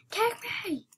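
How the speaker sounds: high-pitched begging cry; urgent mock plea. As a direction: beg in a high, playful squeal. Begging to be taken.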